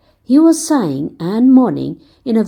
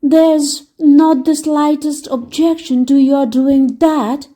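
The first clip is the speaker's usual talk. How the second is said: gloomy egg prince; 6 semitones higher than usual. Acted. Humpty Dumpty warning Dick, lightly mournful. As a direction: warn the boy with weary gloom.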